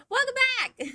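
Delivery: very high, loud cheerful welcome; bright shouted welcome back; excited greeting. Real, not acted. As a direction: greet the chat with a burst of energy. Greets chat after ad break.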